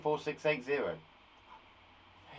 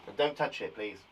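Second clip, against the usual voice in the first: normal talking voice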